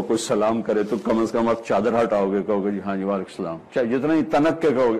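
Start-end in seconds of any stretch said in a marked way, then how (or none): 2.7-3.6 soft, low reply in passing; casual grudging reply. Acted. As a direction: drop the greeting, quieter and lower. Voices a reluctant, muttered return greeting.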